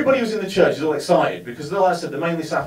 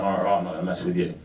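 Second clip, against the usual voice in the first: lads' mutter; 10 semitones lower, 8 dB quieter, much faster than usual. Retold or imitated. Gorillas sitting down, voiced.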